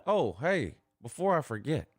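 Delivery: ordinary talk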